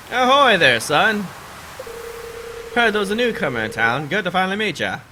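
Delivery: put-on high, hearty old sea dog; sailor voice. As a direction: boom it out like a salty sailor. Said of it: "This is my sailor voice".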